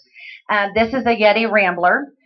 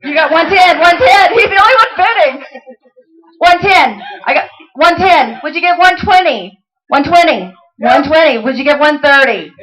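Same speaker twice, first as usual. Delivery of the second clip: high, loud auction chant; student auctioneer spinning the bids, loud and high; auctioneer bid calling; 6 semitones higher, 6 dB louder than usual. Acted. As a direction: rattle off bids like a pro auctioneer.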